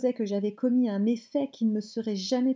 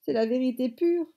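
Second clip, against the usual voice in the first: higher, lightly whining grandmother; whimpering; 5 semitones higher, 4 dB louder than usual. A narrator's reading. Grandmother insisting to mother it is true, whimpering. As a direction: insist with a touch of tearful whine. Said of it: "pleurnicha grand-mère".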